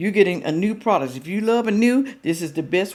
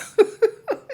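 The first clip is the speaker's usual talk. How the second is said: high, amused laugh; burst of laughter. Real, not acted. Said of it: "laughing at pronunciation".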